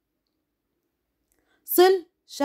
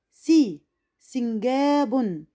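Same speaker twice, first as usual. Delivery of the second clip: slow syllable reading; much slower than usual. Shown as a demonstration. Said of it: "تعالوا نشوف ده في كلمات".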